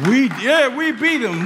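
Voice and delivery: high, loud mock victory cry; mock cheering. Preacher mimics the onlookers boasting, loud mock cheer. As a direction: crow like fans claiming someone else's win.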